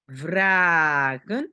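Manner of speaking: "Vragen" with a very long a; open-syllable demo. Shown as a demonstration. Teacher shows learners an open syllable, drawn-out demo. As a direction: hold "Vra", then add "gen". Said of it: "syllabe ouverte".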